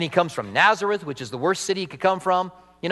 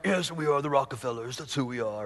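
proud rich family; 4 semitones lower, 7 dB quieter than usual. Pretend. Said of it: "if you're proud of your family".

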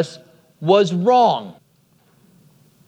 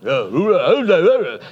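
low, slurred boxer impression; Rocky; 4 semitones lower, slower than usual. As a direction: mumble it like a punch-drunk movie boxer. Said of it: "That's my Rocky now".

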